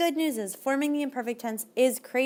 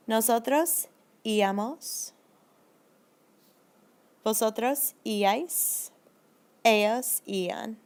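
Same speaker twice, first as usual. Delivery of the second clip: slow model forms; slower than usual. Shown as a demonstration. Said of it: "teacher models endings".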